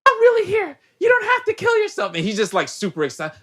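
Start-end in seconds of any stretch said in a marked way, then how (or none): 0.1-2.1 squeaky high-pitched Bella impression, a bit louder; high mock Bella. Bella reassuring Edward, in a high mock girly voice. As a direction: pitch way up like a breathless girl.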